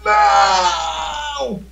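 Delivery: desperate cry. Acted. Grito de susto em cena.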